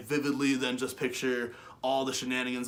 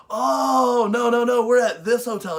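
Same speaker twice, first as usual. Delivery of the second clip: high, loud "oh no no no"; mimicking the friend, high and loud; friend correcting; 9 semitones higher, 11 dB louder, slower than usual. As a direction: voice her as she realises the mistake.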